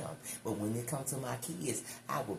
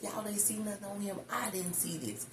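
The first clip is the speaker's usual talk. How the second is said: retold disbelief; 9 semitones higher than usual. Quotes herself challenging school staff.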